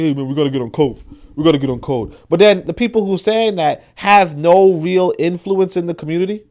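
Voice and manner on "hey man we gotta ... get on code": lightly voiced casual urging, loose and conversational; imitating casual talk. Mimicking how folk talk among themselves, lightly imitated casual voice. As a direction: mimic everyday folk urging each other.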